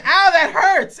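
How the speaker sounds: high loud laughing yelp of pain; mock pained yell. Retelling his own reaction to the sting, laughing mock yelp. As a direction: squeal it out through laughter.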